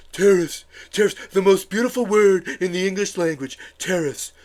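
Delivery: mocking impression with oddly shaped mouth; Donald Trump. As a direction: mangle the words in a mock politician's voice. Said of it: "how does he talk with his mouth like this".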